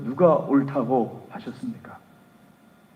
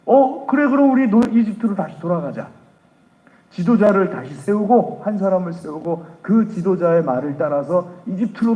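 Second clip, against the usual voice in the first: crowd clamoring; 5 semitones higher, 4 dB louder than usual. Acted. Israelites urging return to Egypt.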